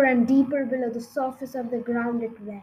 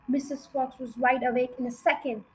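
ordinary delivery

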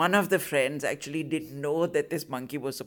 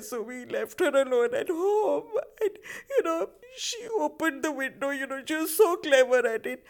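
high, wailing fake sobbing story; fake-cry it much higher, like a grieving kid putting on a show; mock weeping; 10 semitones higher than usual. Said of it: "weeping away, weeping away".

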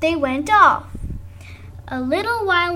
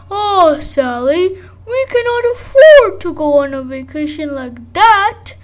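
lightly voiced father; 2 semitones higher, 8 dB louder than usual. A narrator's reading. Father refusing in story.

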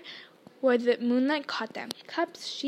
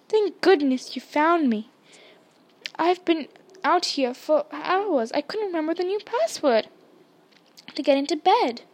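lightly voiced anxious boy, slightly raised; a hint of a plaintive child; relieved boy; 4 semitones higher, 5 dB louder than usual. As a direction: pour out relief at being found. Frightened Neville greets his rescuers.

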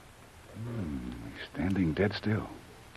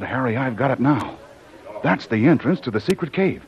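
louder and higher, thrilled discovery; triumphant excitement; 6 semitones higher, 8 dB louder than usual. Acted. Jungle Jim finding the secret cave, loud thrilled triumph. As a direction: announce the find like a victory.

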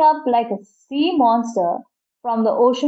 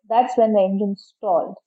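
plain reading voice

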